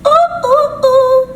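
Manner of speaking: high, loud ooh of delight; real excited oohs; delighted squeal. Real, not acted. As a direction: squeal with joy at the taste. Tasting food, delighted.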